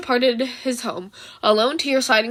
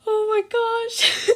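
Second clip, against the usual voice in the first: gasping laugh, much higher, slow; breaking up with laughter; 10 semitones higher, much slower than usual. Real, not acted. The reader cracking up at the ending, laughing.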